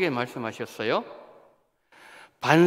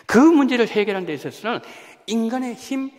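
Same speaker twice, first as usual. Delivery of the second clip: ordinary delivery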